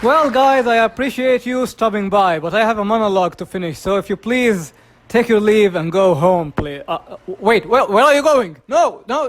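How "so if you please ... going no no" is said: ordinary talk, nothing marked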